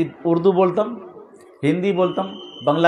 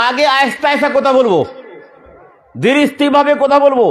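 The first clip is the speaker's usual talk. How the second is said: high, raised declaiming in separate phrases; emphatic preaching; 8 semitones higher, 5 dB louder than usual. Acted. Preacher explaining how his sermon will begin, emphatic. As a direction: announce each step in a ringing high voice.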